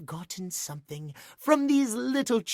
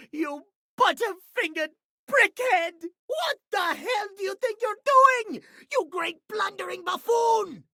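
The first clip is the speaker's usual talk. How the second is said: higher, shouted tirade at a clumsy fool; angry outburst; 10 semitones higher than usual. Acted. Pocket watch yelling at the person who fumbled it, furious shout. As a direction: blow up at whoever dropped you.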